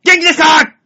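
loud, fired-up wrestler's shout; hammed-up Inoki catchphrase; Inoki impression. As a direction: bellow it like a pro wrestler rallying a crowd. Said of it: "人の芸のパクリ".

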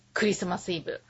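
ordinary talk, nothing marked

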